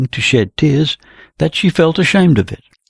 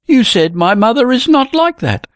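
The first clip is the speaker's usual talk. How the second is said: "not" pushed high in a raised accusing voice; stress on not; 10 semitones higher than usual. Acted. Helg accuses Jenny of slighting his mother, stressing "not". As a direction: lean on "not" as you accuse her. Lovers' quarrel over wording.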